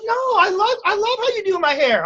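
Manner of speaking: protesting client. Retold or imitated. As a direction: protest in a high imitation of the client. Client protests in retold talk.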